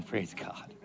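breathy whispered praise God; whispered aside. Real, not acted. Pastor whispering an aside to himself after a joke. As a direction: slip in a quiet aside.

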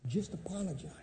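soft warning muttered in passing; quiet aside. Retold or imitated. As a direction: slip the advice in quietly over his shoulder. Gang member muttering behind him.

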